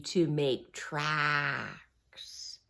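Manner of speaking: very slow, low and soft; slow dragged words. Acted. Reading to kids, slow dragging words. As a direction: drag the words like dragging feet.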